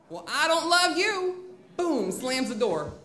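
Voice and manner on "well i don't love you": loud, high, slow mock outburst; defiant retort. Student leaving to the teacher, defiant yell. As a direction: imitate a kid flinging it back. Student yells back before slamming door.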